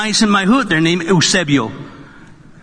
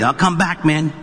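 usual speaking voice

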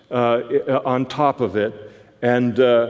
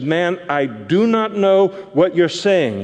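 higher, pleading denial leaning on "not"; Peter's third denial; 7 semitones higher, 3 dB louder than usual. Pretend. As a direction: brush off the question and feign confusion.

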